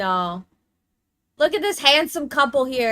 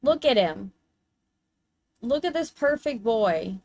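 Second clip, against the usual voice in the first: ordinary talk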